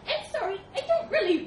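high, loud squeaky apology; apologetic little mouse. Acted. Mouse apologising to the thundering elephant, squeaky.

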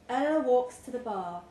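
usual speaking voice

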